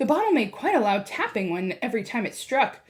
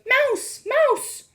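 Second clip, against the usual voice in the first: shouts; 4 dB louder than usual. A narrator's reading. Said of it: "shouts of".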